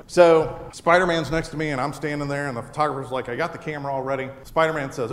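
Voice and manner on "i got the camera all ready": rushed photographer. Retold or imitated. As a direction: rush it out like a busy photographer. Photographer hurrying the shot.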